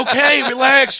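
high, loud plea; exasperated retort. Acted. Worn-out uncle to shouting toddler, loud exasperated retort. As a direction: push back loudly at a relentless kid.

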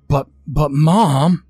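a betrayed son faltering on but, but; hurt child stammering at his mother; stuttering in dismay. A narrator's reading. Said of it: "Jack stuttered".

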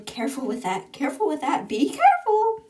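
fretful warnings building to a high-pitched cry; escalating warning. Acted. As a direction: start ordinary, climb to a high, loud squeal. Anxious warning repeated and escalating.

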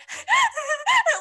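high loud wailing sob. Retold or imitated. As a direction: wail out a sob. Acting out uncontrolled crying.

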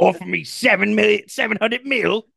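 high-pitched comic Australian; hammed-up Australian boast; Australian crocodile hunter. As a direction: tell it in a high mock-Australian voice. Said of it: "the crocodile hunter".

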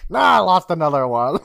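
loud hammed-up wail of defeat; mock upset screaming. Podcaster as old man who lost another, mock screaming. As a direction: cry out in comic frustration. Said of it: "the old man starts screaming".

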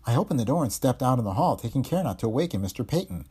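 ordinary delivery

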